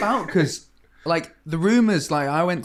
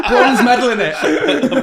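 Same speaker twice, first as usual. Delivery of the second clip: laughing; 9 semitones higher, 6 dB louder, much slower than usual. Real, not acted. Laughing banter.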